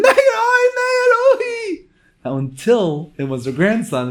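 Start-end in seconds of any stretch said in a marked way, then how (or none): 0.0-1.8 shrill wailing scream, much higher and louder. Retold or imitated. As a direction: wail in panic for your grandson. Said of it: "she starts screaming".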